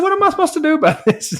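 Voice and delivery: high, laughing mock despair; a retold plea to the neighbor, half laughing; exasperated laugh. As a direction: throw up your hands, laughing.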